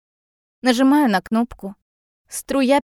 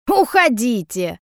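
slow, drawn-out angry shout; 4 dB louder than usual. A narrator's reading. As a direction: shout them out of the house. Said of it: "кричит она".

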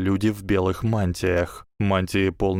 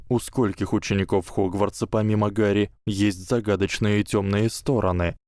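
ordinary delivery

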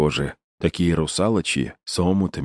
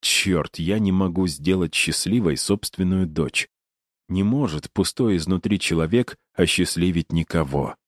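usual speaking voice

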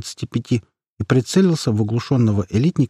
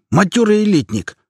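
high, urgent battle commands; shouting orders; 7 semitones higher, 3 dB louder than usual. A narrator's reading. Squad leader directs fighters at the monster, shouting. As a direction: rap out orders over the chaos. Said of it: "крикнул чех".

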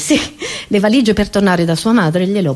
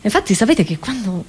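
ordinary delivery

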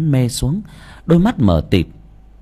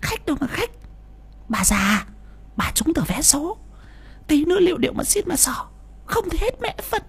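much higher, thin scolding wife voice; greedy wife hissing a sharp high retort; snapping wife; 7 dB quieter than usual. Acted. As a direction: snap at the husband in a high shrewish voice. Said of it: "Mụ gắt".

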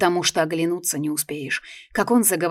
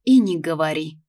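fervent agreement; 6 dB louder than usual. Acted. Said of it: "горячо поддержала я".

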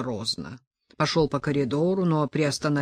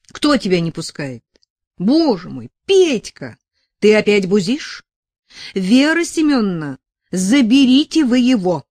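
higher and louder, scolding the brawler; sharp exasperation, call for help; exasperated scolding; 5 semitones higher, 9 dB louder than usual. A narrator's reading. Sasha yelling at Petka to stop, then calling the mother, exasperated and loud.

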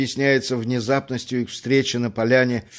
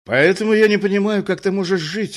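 higher, louder, goading tone; provocative; 6 semitones higher, 4 dB louder than usual. A narrator's reading. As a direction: needle her on purpose. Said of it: "сказал он провокационно".

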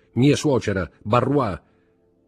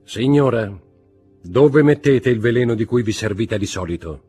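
loud, higher, point-blank questioning; clear and blunt; 4 semitones higher, 8 dB louder than usual. A narrator's reading. Magistrate confronts his wife over the poison, bluntly. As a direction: demand it clearly, without preamble. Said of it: "disse chiaramente senza preamboli".

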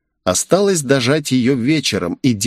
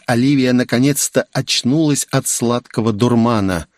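ordinary delivery

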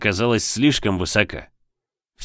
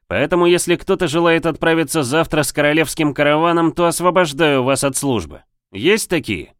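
loud, high commander address; roaring rallying speech; 7 semitones higher, 3 dB louder than usual. A narrator's reading. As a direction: project it to the troops.

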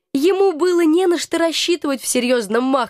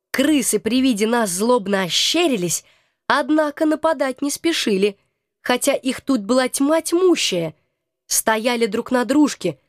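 usual speaking voice

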